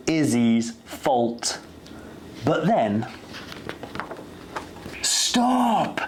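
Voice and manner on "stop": put-on high sudden child shout of stop; a girl shouting stop over the noise. A narrator's reading. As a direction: yell it to halt the chaos. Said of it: "shouted Izzy at the top of her voice".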